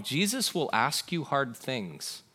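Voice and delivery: usual speaking voice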